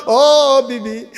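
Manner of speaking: high, drawn-out tearful call. Acted. Husband in tears answers his wife, plaintive call. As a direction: call out plaintively, stretching the vowels. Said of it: "চোখের পানি ছাইরা দিয়া কয়".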